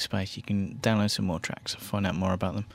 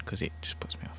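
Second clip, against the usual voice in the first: softer whispered grumble; whispered aside; 3 semitones lower, 10 dB quieter than usual. Real, not acted. DJ fed up with the fan noise, whispered. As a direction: whisper it, annoyed under your breath. DJ mutters about the distracting noise.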